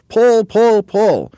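repeated high, loud heaving yells; Mugglewump yelling. A narrator's reading. Monkey leader urging haulers, yelling. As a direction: yell each one as a separate heave. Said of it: "yelled Mugglewump".

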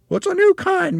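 very high, loud character voice; shrill wife voice. A narrator's reading. Mrs. Twit passes off worms to her husband, shrill wife voice. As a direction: pipe it up high and brazen.

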